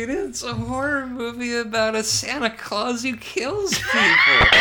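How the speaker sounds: excited high laughing shout. Real, not acted. Excitedly describing killer Santa.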